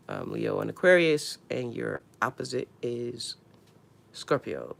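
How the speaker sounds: usual speaking voice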